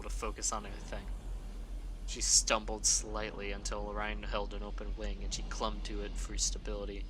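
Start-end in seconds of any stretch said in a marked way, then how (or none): none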